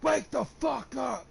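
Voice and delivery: high, drawn-out mock yell; retold teacher's yell. Imitating PE teacher waking a knocked-out student, yelling. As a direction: bellow it slowly like an irate coach. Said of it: "P E teacher's yelling at me".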